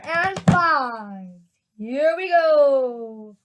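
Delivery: big announcer build-up with drawn-out words. Acted. Storyteller introduces the gum adventure to listeners, showy announcer voice. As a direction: count them in like a showman, loud and drawn out.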